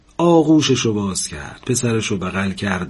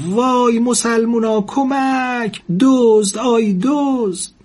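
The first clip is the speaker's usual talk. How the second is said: very high, drawn-out cry for help; screaming; 13 semitones higher, 3 dB louder, much slower than usual. A narrator's reading. Old woman alone, fearing a thief, screaming for help. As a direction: shriek in alarm to rouse the neighbours. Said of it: "جیغ کشید".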